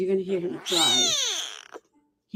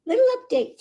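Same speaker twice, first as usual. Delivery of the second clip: "Little update" squeaked much higher; high cooing; 5 dB louder, faster than usual. Real, not acted. Rescuer repeats the update to the kitten, "Little update" much higher.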